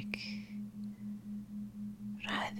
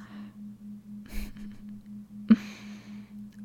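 whispered mocking laugh.

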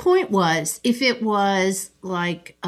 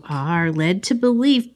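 usual speaking voice

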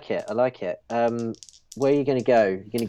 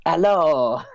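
put-on French accent; 6 semitones higher, 4 dB louder than usual. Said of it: "your French accent".

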